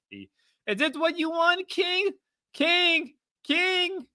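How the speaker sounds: very high, slow falsetto; mock taunting. Streamer calling King to look, mock falsetto taunt. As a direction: squeal the name slowly, teasing.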